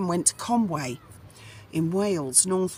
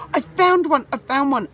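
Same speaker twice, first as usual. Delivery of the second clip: higher and louder, thrilled re-enactment; excited discovery; 8 semitones higher, 6 dB louder than usual. Retold or imitated. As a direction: blurt it out like you just struck gold. Retold moment of finding the advert.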